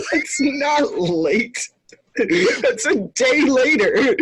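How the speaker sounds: high laughing protest, nearly crying with laughter; laughing hard. Real, not acted. Friend mocks a late departure, laughing until near tears. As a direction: squeal it out through helpless laughter.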